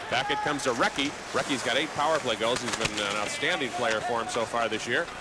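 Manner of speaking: usual speaking voice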